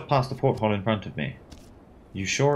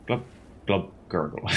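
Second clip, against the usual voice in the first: mock drowning gurgle; 3 dB quieter than usual. Drowning sounds voiced.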